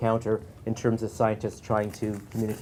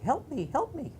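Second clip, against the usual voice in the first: tiny, much higher voice, a bit softer; a far higher, softer little voice; little frog voice; 4 dB quieter than usual. Acted. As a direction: call out small and high like a tiny frog. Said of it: "hear a little voice on the side of the road".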